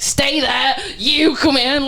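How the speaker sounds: high, urgent mock command; retold order. Bartender herding brawling women behind the bar, retold urgent command. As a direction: order them about, acted out.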